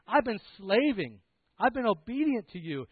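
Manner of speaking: loud, high, aggrieved; martyred older brother's outburst; resentful protest. Acted. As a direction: complain indignantly, leaning on each effort.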